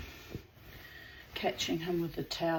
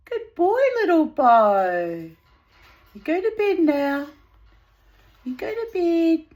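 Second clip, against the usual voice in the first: high, loud sing-song pet talk; 7 semitones higher, 12 dB louder than usual. Real, not acted. Owner cooing at her pet bird to go to bed, high sing-song.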